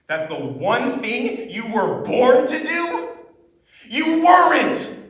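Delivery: intense high point. Acted. Student at the high point of a monologue, intense. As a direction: build to a high, loud, angry peak. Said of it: "this monologue has high points and it's very intense".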